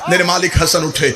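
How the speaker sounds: usual speaking voice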